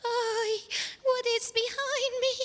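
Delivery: put-on high, anxious child voice; acted frightened boy. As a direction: play a scared little boy in a high voice. Boy afraid to look.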